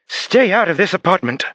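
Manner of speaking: very high, loud near-scream. Acted. Therapist ordering the patrolmen out, near-screaming. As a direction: shriek them out of the flat. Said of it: "raised her voice close to a scream".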